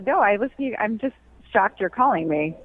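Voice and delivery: normal talking voice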